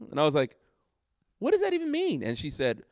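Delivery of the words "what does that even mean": high, disbelieving squeak of a question; mock-stunned what does that even mean; incredulous. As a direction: toss it up in shock, half-mock. Retelling his stunned reply.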